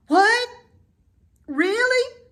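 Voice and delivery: very high, loud disbelieving cries; startled cry. A narrator's reading. Wilbur cries out in hopeful disbelief. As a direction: leap up in hope. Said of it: "cried Wilbur".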